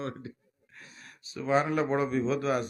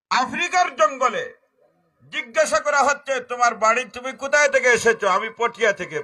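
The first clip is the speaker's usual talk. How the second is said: high, loud storytelling sermon; loud preaching; 11 semitones higher, 10 dB louder than usual. Acted. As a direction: call the jungle scene out to the crowd. Retelling jungle encounter, preaching loud. (চড়া গলায় ওয়াজের গল্প)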